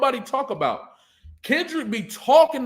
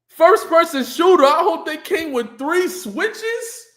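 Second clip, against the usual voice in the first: higher, louder, slow and savoring every word; hyped replay of the bar; 7 semitones higher, 6 dB louder than usual. Retold or imitated. Reacting to a rapper's bar, hyped and slow. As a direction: relish the bar like it just knocked you out.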